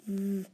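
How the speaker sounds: "V" as a held buzzing vvv; letter sound V. Shown as a demonstration. Teacher quizzing pupils on spelling sounds, isolated letter sound. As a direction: hum the consonant "V" alone. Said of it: "V sound".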